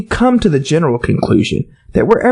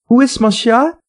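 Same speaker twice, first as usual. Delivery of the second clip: higher, careful lesson-book French; schoolgirl French; 8 semitones higher than usual. A narrator's reading. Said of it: "first sentence in her French lesson book".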